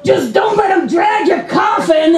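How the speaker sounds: high, slow theatrical holler; drawn-out mock shout from up the street; acted shout. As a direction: call it out like a dramatic villain. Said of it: "he shouts at me".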